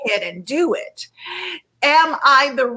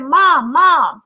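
retold call to mom, louder; raised, reaching call, retold; 3 semitones higher, 6 dB louder than usual. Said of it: "and I called her".

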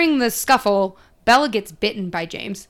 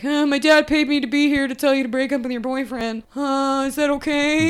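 put-on goofy, slightly higher teen voice; a dopey kid reciting his errand; awkward Jacob; 4 semitones higher than usual. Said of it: "He's like".

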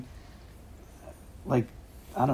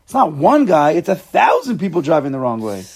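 a lightly voiced old man, louder, insisting; oblivious old husband; 4 semitones higher, 7 dB louder than usual. Old husband driving wrong way retorts to wife, mildly indignant. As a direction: insist it's everyone else, mock-indignant.

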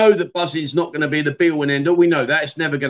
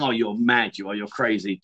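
softer, lower friendly tease; fond quiet teasing; jovial teasing; 6 semitones lower, 5 dB quieter than usual. Shown as a demonstration. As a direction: gently tell a mate he's mad. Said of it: "a jovial way of saying".

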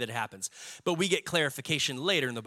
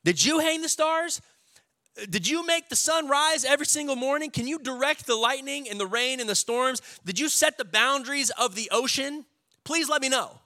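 God's rebuke; 8 semitones higher, 3 dB louder than usual. Retold or imitated. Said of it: "he finally says".

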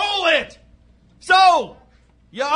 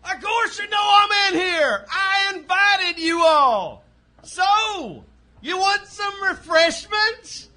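defiant shout back. Acted. Outlaw Clyde answers the posse from his mine, hollering. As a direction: holler back at the people outside.

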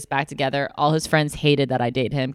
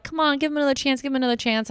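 much higher pleading voice; imitating his pleading family; 10 semitones higher, faster than usual. His mother and sister begging her to take him back, in a high coaxing voice. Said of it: "and they're like".

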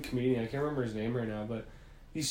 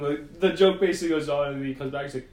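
ordinary delivery

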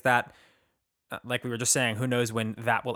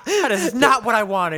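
slow, louder, higher, through laughter; laughing mock complaint; 10 semitones higher, 8 dB louder, slower than usual. Cohost finishing the creamy joke, laughing. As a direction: gripe while cracking up.

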